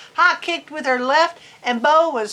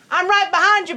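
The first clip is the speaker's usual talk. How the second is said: calling out; 5 semitones higher than usual. A narrator's reading. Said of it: "Bo called".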